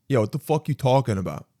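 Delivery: ordinary talk, nothing marked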